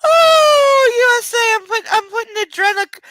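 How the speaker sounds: high-pitched outraged squeal; high outraged cry. Real, not acted. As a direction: cry out in disbelief. Outraged reading the name.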